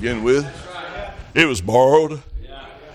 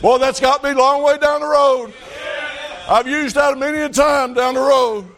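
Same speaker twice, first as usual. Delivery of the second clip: full-force preaching; 12 semitones higher than usual. Acted. Preacher tells the church God lets them pay, in full cry. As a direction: preach it out to the back pew.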